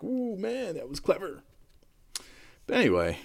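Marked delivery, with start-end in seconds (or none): 0.0-1.4 impressed listener. Retold or imitated. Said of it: "That could have just been like".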